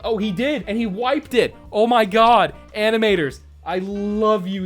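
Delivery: loud, high delighted outburst; excited. Real, not acted. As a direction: gush about it loudly. Delighted by an animation detail.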